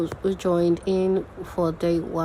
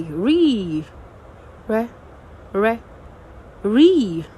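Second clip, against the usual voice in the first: long swelling "ree" against short, lighter "re"; isolated syllables, the primary one drawn out and pushed; syllable stress demo; 3 semitones higher, 3 dB louder, much slower than usual. Shown as a demonstration. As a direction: contrast the stressed syllables of "referee" one at a time. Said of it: "not as stressed as the primary".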